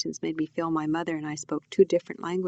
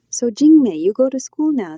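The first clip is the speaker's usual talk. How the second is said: loud, high, prying auntie; auntie's pointed query; 5 semitones higher, 10 dB louder than usual. A narrator's reading. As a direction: pipe up across the table with a nosy question. Auntie pointedly questions the narrator.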